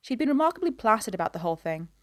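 plain reading voice

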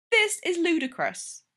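high, slow, exasperated huff; slow, high-pitched indignant complaint; huffy. A narrator's reading. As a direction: huff it out in disbelief. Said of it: "Charles huffed to Thor".

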